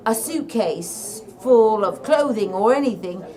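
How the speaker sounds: light Irish lilt, retelling the DJ; a hint of an Irish DJ telling his story; Irish accent. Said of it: "in an Irish accent".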